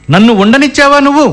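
heated accusation. Acted. Husband repeats his charge at wife, heated.

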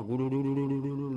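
imitated bubbling underwater; drowning gurgle. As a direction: gurgle like sinking in water.

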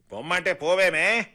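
high, loud angry yell; loud shout. A narrator's reading. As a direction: shout at the woman to get out. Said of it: "గట్టిగా అరిచాడు Bastian". (గట్టిగా, కోపంగా అరవడం)

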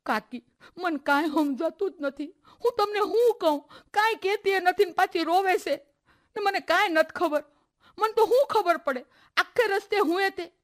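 high, sobbing words to the aunt; a girl crying hard as she tries to explain; sobbing while talking. Acted. Harsha answers aunt while sobbing, high and upset. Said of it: "હું એ ધ્રુસકે ધ્રુસકે રોઉં છું".

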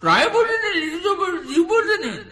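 very high, loud mimic squeal; flustered passenger. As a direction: call out hurriedly in a very high voice. Acted reply of passenger getting off. (చాలా ఎత్తైన గొంతుతో కంగారు కేక)

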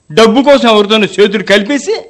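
normal talking voice

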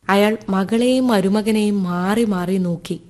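ordinary delivery

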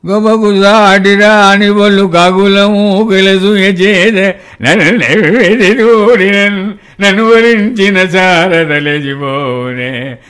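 put-on slow, sing-song poetic recitation in the old poet's voice; imitating Jashuva reciting. Mimic recites Jashuva's poem to the poet himself, slow and measured. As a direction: recite the verse slowly like the elderly poet. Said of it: "Jashuva గారు, ఆ, నేను ఒక పద్యం చదవండి".

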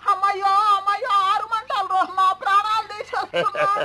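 high, fast cries of burning pain; anguished wail. Acted. Bound man wails at his tormentors, in high anguished cries. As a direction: wail as if the lime is scorching you.

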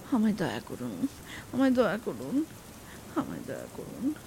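low, humble repeated plea; pleading. A narrator's reading. Habu begs with folded hands.

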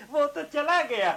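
high, laughing delivery. Acted. Father jokes that the guest has left, laughing. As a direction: burst out chuckling around the words. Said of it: "आप हंस क्यों रहे हैं".

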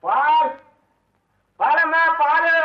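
hawker's call, high and loud; calling out milk. Acted. Milkman hails the lady of the house, loud high call.

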